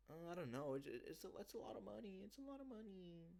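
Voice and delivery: high, soft put-on voice fretting about money; squeaky parody of a worried client; mock hesitant client. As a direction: whine softly like a nervous buyer.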